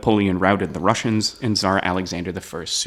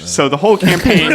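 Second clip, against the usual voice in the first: words breaking up in laughter; trying to talk through giggles; laughing; 12 semitones higher, 7 dB louder than usual. Real, not acted. Host restarting the point, laughing at the joke.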